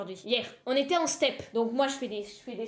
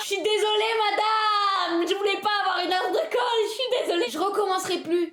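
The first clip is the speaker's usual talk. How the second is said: pleading pupil; 7 semitones higher than usual. Pretend. Pupil begging her teacher not to give detention, high and pleading. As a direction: beg the teacher like a kid caught out.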